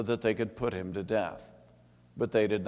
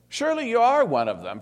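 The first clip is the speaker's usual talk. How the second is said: high, loud, fast accusation; accusing bystander; 14 semitones higher, 8 dB louder, faster than usual. A narrator's reading. Bystanders accusing Peter by the fire, high and loud. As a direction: pounce on him with the charge.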